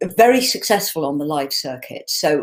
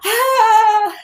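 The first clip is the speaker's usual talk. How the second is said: excited shriek; 5 dB louder than usual. Retold or imitated. Reliving the sound shutting down.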